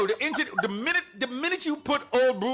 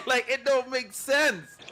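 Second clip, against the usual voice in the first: ordinary talk, nothing marked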